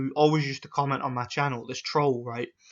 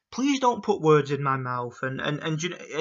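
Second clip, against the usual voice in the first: usual speaking voice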